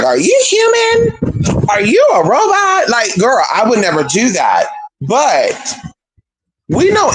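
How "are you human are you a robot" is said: mock-accuse someone of being a robot; freaked-out shout. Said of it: "go around and be like".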